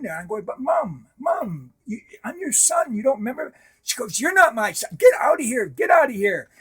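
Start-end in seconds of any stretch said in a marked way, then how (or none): 0.4-3.5 pleading. Retold or imitated. Pleading with mother. 4.2-6.5 high, loud order to get out; mother shouting at a son she doesn't know; retold angry shout.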